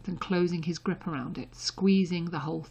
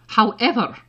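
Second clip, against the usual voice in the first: a pointed, louder "However"; 4 semitones higher, 9 dB louder than usual. A narrator's reading. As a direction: land it as a sharp warning. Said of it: "she added pointedly".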